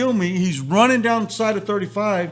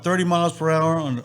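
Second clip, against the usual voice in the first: ordinary delivery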